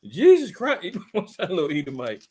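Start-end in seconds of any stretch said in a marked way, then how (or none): 0.1-1.0 high meme imitation of a scared kid; mock startled. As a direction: yelp it as a comic fright. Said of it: "somebody scares him".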